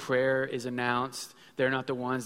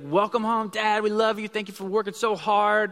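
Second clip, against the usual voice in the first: put-on much higher, louder, gushing kids' welcome; kids' big welcome; 8 semitones higher, 6 dB louder than usual. Pastor imitates his kids greeting him at the door, high and gushing. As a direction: gush like excited kids greeting Dad. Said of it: "that big".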